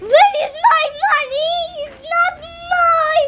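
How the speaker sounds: high, loud, hurried panic; hammed-up distressed question; acted alarm. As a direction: search in mock panic, pitched right up. Maria discovers her money is gone.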